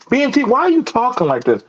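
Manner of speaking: mock challenge. People questioning how he talks, mock challenge.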